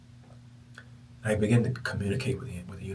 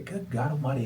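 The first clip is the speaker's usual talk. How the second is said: ordinary delivery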